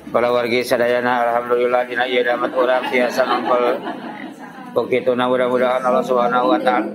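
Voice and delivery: low, level preacher's drone on one note; monotone. Shown as a demonstration. Preacher greeting the congregation, droning in a flat monotone. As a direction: hold every word on the same low pitch. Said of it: "Lempeng, nada-nado semua".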